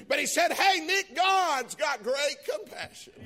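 high, loud preaching, slow on the big words; preaching at full force. Acted. Preacher shouting good news to the congregation, high and loud.

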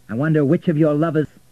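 loud, high accusation; angry jibe. A narrator's reading. Richard taunting Florentina about lovers, angry and loud. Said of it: "never seen Richard angry before".

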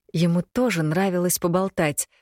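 usual speaking voice

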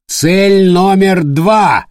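high, loud, very slow order; shouting. Acted. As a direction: bark the target out. Captain giving battle order.

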